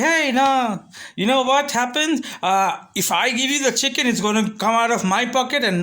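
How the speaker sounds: high-pitched mock excuse; pleading seller. Seller telling customer it costs his pocket, pleading. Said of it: "Then he was like".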